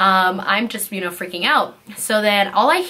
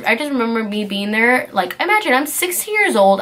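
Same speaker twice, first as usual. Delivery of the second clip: normal talking voice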